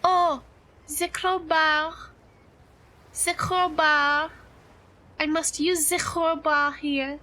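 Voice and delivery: hammed-up, mock French accent; horrible French accent. Streamer playing up a horrible accent redeem for chat, hammed-up French accent. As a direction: put on a deliberately bad French accent. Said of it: "It wouldn't be French".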